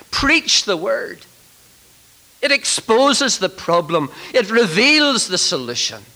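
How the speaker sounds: high, loud, ringing pulpit proclamation; full-force preaching. Real, not acted. Preacher urging ministers to preach the word, at full force. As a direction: hammer out each phrase to the congregation.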